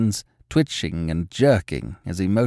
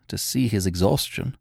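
usual speaking voice

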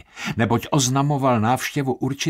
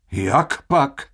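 higher, louder call of disbelief; fairly loud exclamation; 4 semitones higher, 4 dB louder than usual. Acted. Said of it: "zvolal Baldini dosti hlasitě". (dosti hlasité zvolání)

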